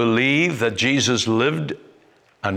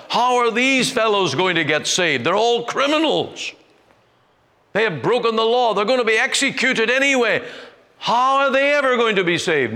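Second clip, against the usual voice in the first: 1 scornful voice; 11 semitones higher, faster than usual. Retold or imitated. Scornful imagined quote about criminals.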